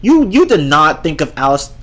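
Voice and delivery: normal talking voice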